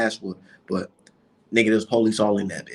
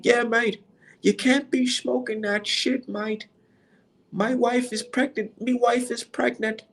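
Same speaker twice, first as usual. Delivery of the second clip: high, drawn-out mock British accent; 12 semitones higher, slower than usual. British fan pleads for his pregnant wife, mock British accent. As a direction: do a slow, high-pitched British fan impression. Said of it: "you can just hear that weirdo accent".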